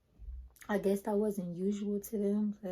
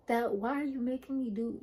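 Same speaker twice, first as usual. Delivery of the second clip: higher, louder indignant protest; going off; 4 semitones higher, 4 dB louder than usual. Retold or imitated. She protests another covid test to the nurses, higher and angry. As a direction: snap back at the nurses. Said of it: "I was going off on these nurses".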